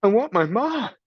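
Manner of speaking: put-on high child's cry for her ma; a kid crying for her mother. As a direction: Meg crying into the waiting world. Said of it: "cried Meg".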